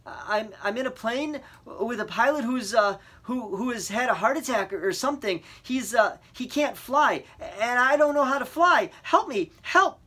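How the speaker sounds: high, loud, stammering panic; panicked plea. A narrator's reading. Boy telling the radio the pilot is down, panicking. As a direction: beg for help, falling apart.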